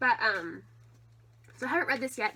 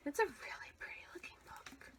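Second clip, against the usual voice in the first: whispering; 3 semitones higher, 12 dB quieter than usual. Real, not acted. Whispered aside about the book.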